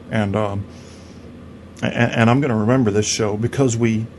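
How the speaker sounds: ordinary talk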